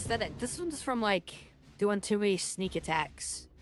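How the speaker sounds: normal talking voice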